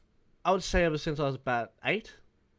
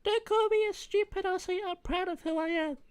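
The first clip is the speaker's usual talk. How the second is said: put-on much higher, childlike voice; high-pitched boy defending his country; young kid voice. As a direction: play a proud little boy sticking up for himself. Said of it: "I'll try and do a young Racket voice".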